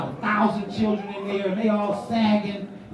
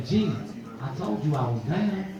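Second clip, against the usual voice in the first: low and cool, no big deal; laid-back friend; 7 semitones lower, 5 dB quieter than usual. Retold or imitated. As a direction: lower easygoing friend voice. Friend repeats he agrees.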